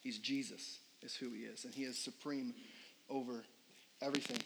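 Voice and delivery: preacher quietly declaring Jesus supreme, reverent and soft; hushed declaration. Real, not acted. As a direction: say it with hushed awe.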